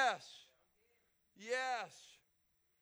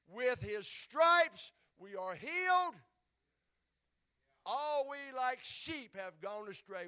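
preaching scripture; 6 dB louder than usual. Acted. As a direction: proclaim the verses like a herald. Reciting Isaiah with force.

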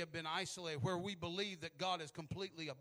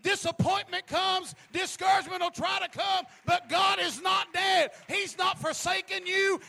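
preaching at full force; 14 semitones higher, 16 dB louder than usual. Acted. Preacher rallying the congregation, shouting each line. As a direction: ring each claim out like a battle cry.

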